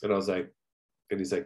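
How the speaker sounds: ordinary talk, nothing marked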